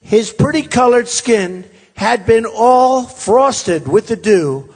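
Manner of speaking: big storybook delivery, drawn out; dramatic lyric reading. Acted. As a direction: declaim it like a fable, stretching each phrase. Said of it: "the snake lyric".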